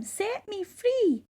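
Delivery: high, slow pleading mermaid; begging. A narrator's reading. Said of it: "when she begged him".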